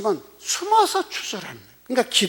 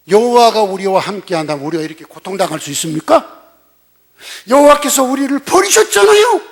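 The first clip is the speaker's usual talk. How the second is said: startled protest; 3 semitones higher, 8 dB louder than usual. Retold or imitated. Startled Gideon answering the angel, loud protest. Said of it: "기드온이 깜짝 놀라서".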